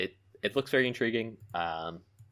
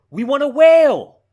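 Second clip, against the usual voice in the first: high, loud, drawn-out wail; slow, soaring cry on the last word; excited wail; 12 semitones higher, 13 dB louder, slower than usual. Real, not acted. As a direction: belt it out like a eureka moment. Excitedly lands on the series name.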